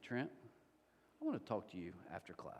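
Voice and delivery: a calm, hushed teacher at the desk. Retold or imitated. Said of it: "And she was so calm".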